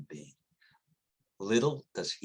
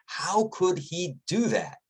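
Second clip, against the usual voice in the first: incredulous question; 9 semitones higher, 6 dB louder than usual. Retold or imitated. Outsider asking a doubting question.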